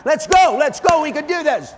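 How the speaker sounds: loud, high pep-talk shout; big cheering call to go for it; rallying cry. Retold or imitated. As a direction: fire up the crowd to charge the mountain. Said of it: "I'll say".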